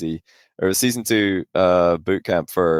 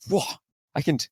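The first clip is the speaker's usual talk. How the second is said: mock amazed friend; 12 semitones higher, 4 dB louder, faster than usual. Retells Greasy amazed.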